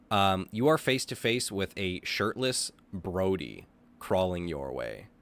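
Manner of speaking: ordinary talk, nothing marked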